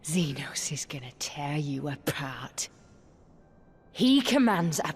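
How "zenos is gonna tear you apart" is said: whispered threat; menacing whisper. Acted. Prisoner to her captor, menacing whisper.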